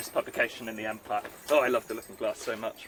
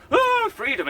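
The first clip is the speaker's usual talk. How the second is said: much higher, slow, squeaky panicked cry; fleeing cultist; 6 dB louder than usual. Retold or imitated. Freedom cultists fleeing the dog, high panicked squeal. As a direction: stretch it out in a silly terrified squeak. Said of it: "And the human said".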